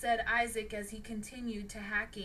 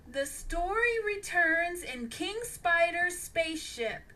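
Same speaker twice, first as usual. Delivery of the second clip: usual speaking voice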